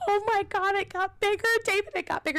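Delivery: high, loud, tearful wail to her husband; retold panicked crying. Wife crying to David that the snake grew, high panicked sobbing. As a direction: sob it out in sudden panic. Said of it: "I immediately start crying".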